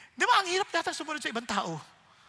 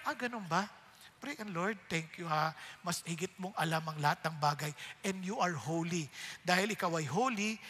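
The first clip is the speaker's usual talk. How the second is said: soft, low, humble prayer; quiet prayer; 7 semitones lower, 5 dB quieter than usual. Acted. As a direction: surrender it all to the Lord softly.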